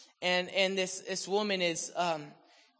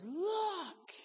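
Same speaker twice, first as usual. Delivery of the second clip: much higher excited call; joyful cry. Retold or imitated. The healed woman runs down the hallway, a joyful cry. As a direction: call out in triumph. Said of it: "and goes".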